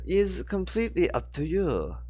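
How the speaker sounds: teasing French swordswoman; French accent. A narrator's reading. Genevieve challenges Rachel in a French accent. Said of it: "Genevieve told her".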